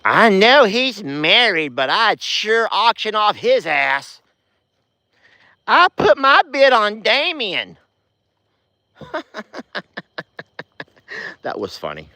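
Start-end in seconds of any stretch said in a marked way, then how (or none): 0.0-7.8 high, slow swooning fan voice; mock a lovestruck viewer; swooning commenter. Vlogger mocking a flirty viewer comment, high swooning voice. 9.0-10.8 breathy high giggle; a quick high laugh. Real, not acted. Vlogger laughing at a funny comment, high giggle.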